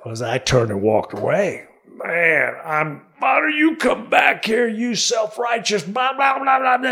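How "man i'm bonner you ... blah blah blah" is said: yelling after him. Retold or imitated. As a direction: bellow it at someone walking away. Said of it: "as they're yelling at me".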